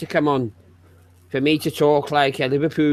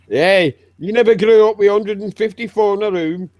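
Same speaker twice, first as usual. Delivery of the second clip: hammed-up Yorkshire rant; 3 semitones higher than usual. Hammed-up northern poverty rant.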